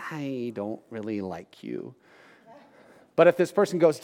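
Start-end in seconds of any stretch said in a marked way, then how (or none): 0.1-2.0 low, dropped-off private verdict; quiet disapproving aside; muttered aside. Retold or imitated. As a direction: say it under your breath, unimpressed. Listener's reaction to bragging.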